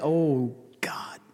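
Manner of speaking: breathless whisper; whispered dread. Retold or imitated. Sister hiding her fear from her sick sister, whispered. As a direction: barely breathe the words out.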